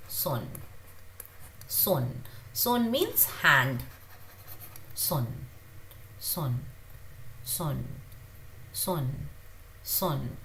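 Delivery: son with the s coming out softly; soft s demo. Shown as a demonstration. Teacher drilling soft son for students, quiet. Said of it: "the S comes out softly".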